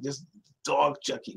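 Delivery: usual speaking voice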